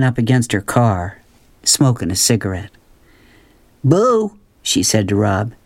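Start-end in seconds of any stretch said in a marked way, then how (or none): 3.8-4.4 light teasing boo, pitched up; a lightly voiced spooky boo; playful boo. A narrator's reading. Willie Mae spooks Rob.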